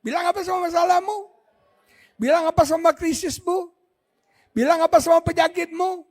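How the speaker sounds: preacher shouting a question to the congregation; rousing call. Real, not acted. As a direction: stir the crowd to answer.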